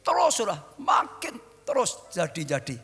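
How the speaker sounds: high, loud preacher's cry to the congregation; fiery preaching. Acted. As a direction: preach it out at full force. Preacher shouting at full force. (berkhotbah dengan berapi-api)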